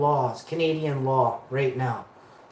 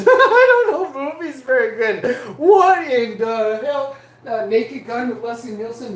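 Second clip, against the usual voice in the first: laughing; 7 semitones higher, 6 dB louder than usual. Real, not acted. Laughing at own blunder.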